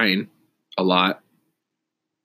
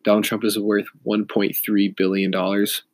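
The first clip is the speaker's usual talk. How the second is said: ordinary talk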